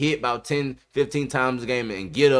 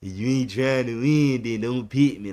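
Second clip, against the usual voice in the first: a hint of his father's voice; sounding like his daddy; 2 semitones lower than usual. Host imitating the quarterback's father, mild mock. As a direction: lightly play the dad saying it. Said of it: "you sound like your daddy".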